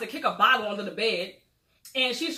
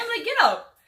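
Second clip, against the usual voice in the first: sharp command; 11 semitones higher, 7 dB louder than usual. Retold or imitated. Chastity retold ordering Emily.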